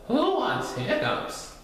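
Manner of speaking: high, slow cartoon animals; high-pitched, unhurried animal chorus; animal friends' voice. A narrator's reading. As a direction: draw it out high like little animals.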